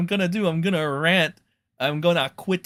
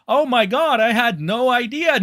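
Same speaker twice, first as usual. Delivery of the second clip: higher mock gasp; imitating creators pretending ignorance; mock fake innocence; 6 semitones higher than usual. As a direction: play dumb sarcastically. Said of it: "Pretending like".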